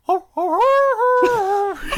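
very high, loud howl into a laugh; howl attempt. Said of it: "It wasn't as good".

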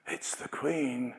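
slow whispered awe; slow hushed it's the Queen; awed whisper. Retold or imitated. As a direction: hush it, the Queen is passing. Retelling Queen passing by.

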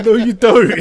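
high, loud protest through laughter; laughing. Real, not acted. Denying he sounds like your mum, laughing. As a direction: crack up while contradicting him. Denying resemblance while cracking up.

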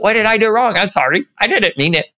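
high, sorry little dog voice; a confused puppy begging forgiveness; lightly whimpering pet asking what it did wrong; apologetic puppy. Retold or imitated. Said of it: "your dog walks over to you and is like".

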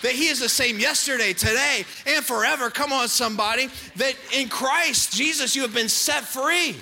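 loud, ringing sermon proclamation; full-force preaching. Acted. Pastor declaring to the congregation, full-force preaching. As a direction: preach it out to the whole church.

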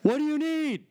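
high, loud mock pharmacist voice, calling across. Pharmacist calls across the store to him, high and loud. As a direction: call out high like a chirpy clerk. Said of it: "and she goes".

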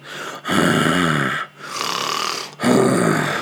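long, low imitated snore; drawn-out mock snoring; loud-honking snore impression. Shown as a demonstration. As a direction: snore in and out like a honker. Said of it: "I honk that snore button".

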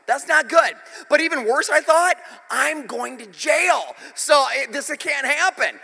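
high, loud mock alarm; hammed-up desperate realization; retold panic. Retelling his mounting panic at sister's danger.

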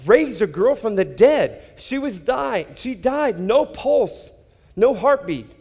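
high, loud, breathless wonder; elated retelling of a miracle; amazed exclaim. Retold or imitated. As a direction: shout in amazed disbelief. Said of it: "I'd be like".

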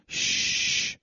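long unvoiced "shh"; retold shush, drawn-out hiss of air. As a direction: shush someone with a long breath. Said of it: "and I shushed him".

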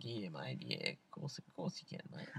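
low, quiet broad Aussie; turned-on Australian accent. Shown as a demonstration. Podcaster showing he can turn it on, low mock Aussie accent. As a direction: slip into an easygoing Australian mate voice, very soft and low. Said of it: "you can turn it on".

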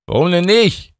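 high, unbothered voice; Mike. A narrator's reading. Mike shrugs off Emma's worry, high and casual. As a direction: brush it off.

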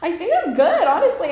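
high, loud, laughing answer; laughing after a cry. Retold or imitated. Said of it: "I was like".

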